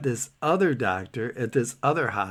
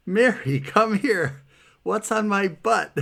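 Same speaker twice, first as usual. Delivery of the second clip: high, raised-voice call for help; retold yell; 8 semitones higher, 4 dB louder, slower than usual. Patient calling Mary into the bathroom, alarmed yell. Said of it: "So I yell".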